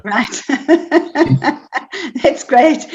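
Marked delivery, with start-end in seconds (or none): 0.5-2.9 laughing through it, a bit higher; real amused laugh into the words; laughing "that's great". Real, not acted. Laughing at the joke.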